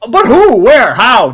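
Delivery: loud, punched one-word questions; demanding interrogation. Real, not acted. Host grills pitcher about his poll, loud and demanding. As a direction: fire each question out loud, demanding proof.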